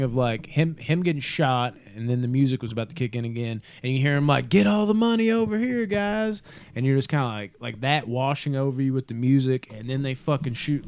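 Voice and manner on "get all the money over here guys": high, loud mock villain call. Podcaster imitating the villain calling out. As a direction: bark the order across the room like the bad guy.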